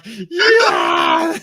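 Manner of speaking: loud, high yelp; mock scream of pain. Acting out man being circumcised, mock pained scream. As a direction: cry out in hammed-up agony.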